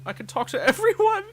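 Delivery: high, loud, laughing delight; excited. Real, not acted. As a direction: burst out with gleeful excitement.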